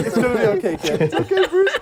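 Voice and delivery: loud, high parody of consoling; hammed-up reassurance through laughter; mock comforting. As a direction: crack up while pretending to comfort. Joke replay of Gordon comforting Bruce.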